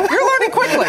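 high, laughing tease; laughing teasingly. Real, not acted. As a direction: laugh it out at a colleague. Teasing co-host after joke.

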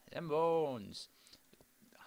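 whispered to himself while sorting; a private whisper over the chest. Real, not acted. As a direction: whisper it under your breath.

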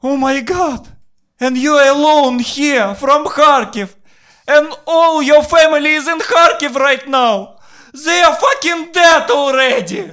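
very high, loud shrieking granny; hysterical old lady. Retold or imitated. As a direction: shriek it like a panicked old woman. Old lady shrieking at Kharkiv news.

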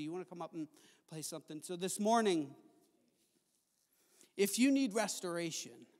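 ordinary talk

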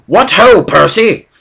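high, loud hearty call across the room; loud, jolly shout from the doorway; cheerful shout. Acted. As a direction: boom it out like a rallying cry. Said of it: "he shouted".

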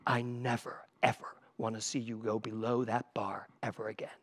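low fierce whisper, voice dropped right down; intense whisper. Retold or imitated. Director grabbing the dancer, fierce low whisper. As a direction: hiss the warning close to his ear. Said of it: "he grabbed me and he went".

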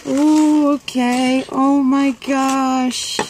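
high, drawn-out delight; delighted gasping awe. Real, not acted. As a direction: coo it high and slow at a gift. Opening the gift, delighted.

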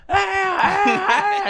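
high loud whooping cry; party whoop. Retold or imitated. Sneaking back into the club, high party whoop.

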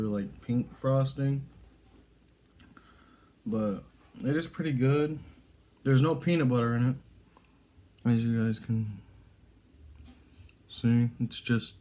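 talking with food in mouth; chewing. Real, not acted. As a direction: mouth partly full. Seen 0: bites wafer just before, jaw and lips working while talking.

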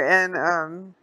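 ordinary delivery

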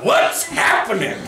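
booming, slowed hearty shout; slap-on-the-back holler; loud hearty greeting. Acted. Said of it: "a hearty slap on the back and a loud".

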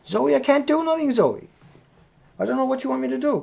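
high-pitched, helpless appeal to the whiner; pleading with a pet. Real, not acted. Host to whining Zoe mid-broadcast, high-pitched pleading.

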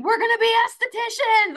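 put-on high, loud kid-like holler; excited kids yelling. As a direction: yell it like thrilled kids running down a hallway. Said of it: "kids running through the halls going".

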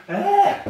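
sharp high groan of effort; strained groan. Real, not acted. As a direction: groan as the weight drops. Putting heavy dumbbell down.

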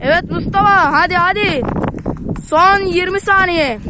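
cheering him on; excited urging. Real, not acted. Urging friend to run.

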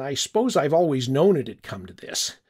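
plain reading voice

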